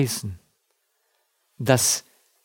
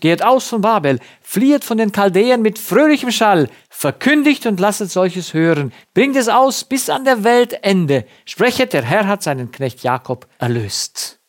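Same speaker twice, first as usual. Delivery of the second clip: raised, ringing proclamation of the verse; scripture reading; 6 semitones higher, 4 dB louder than usual. Acted. Preacher proclaiming Isaiah to the congregation, ringing and raised. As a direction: declaim the prophet's call over the congregation.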